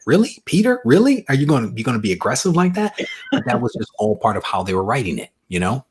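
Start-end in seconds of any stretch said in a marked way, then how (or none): 0.0-3.0 high, loud "really?" of disbelief; retold reproachful astonishment, quickening; incredulous scolding. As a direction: question him like you can't believe his behavior. Retelling own reaction while reading.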